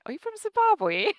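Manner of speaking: imitating an American asking, high; high mock question. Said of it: "ever had anyone say".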